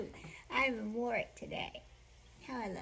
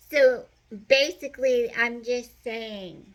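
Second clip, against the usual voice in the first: emphatic; 12 dB louder than usual. Real, not acted. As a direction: lean in and make the point. Emphatic point to viewers.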